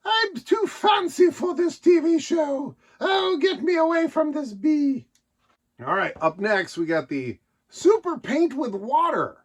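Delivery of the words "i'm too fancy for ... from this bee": a prim grandmother too posh for TV; snooty fancy lady. Retold or imitated. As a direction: look down your nose at the whole show. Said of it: "She's like".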